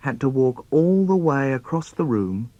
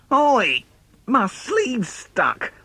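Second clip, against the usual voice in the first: put-on high kid's yelp, then slow; a boy racing his brother, stuck in his shirt; child's cry; 10 semitones higher, much slower than usual. Kid stuck mid-undress.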